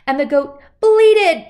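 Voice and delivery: plain reading voice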